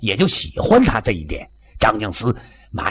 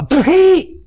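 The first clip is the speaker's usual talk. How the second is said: high, loud "pah" of contempt; scornful spit; 6 dB louder than usual. Acted. Officer mocking any hope of catching Eighth Route soldiers, scornful spit. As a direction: spit the word out derisively.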